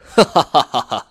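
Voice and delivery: loud, high "ha-ha" laughter; hearty villain laugh. A narrator's reading. Bandit chief laughing at villagers hiding from him, hearty laugh. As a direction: roar with gloating laughter. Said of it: "黄老三哈哈笑着".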